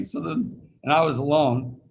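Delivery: normal talking voice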